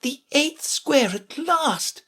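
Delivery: high, slow, drawn-out exclamation; delighted cry. A narrator's reading. Alice bounding over the brook, high triumphant cry. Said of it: "she cried as she bounded over".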